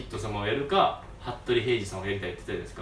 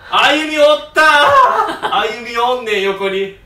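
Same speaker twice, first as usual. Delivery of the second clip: much higher and much louder, laughing; excited outburst; 11 semitones higher, 13 dB louder, much slower than usual. Real, not acted. Telling the fans Ayumi was there, an excited loud outburst. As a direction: blurt the surprise out loud.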